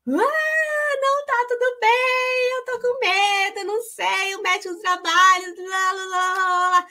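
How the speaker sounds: high, tearful, slow at first, breaking into sobs; retelling a breakdown at work, crying high and choked. Retold or imitated. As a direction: blurt it out through tears, overwhelmed. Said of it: "eu comecei a chorar na hora".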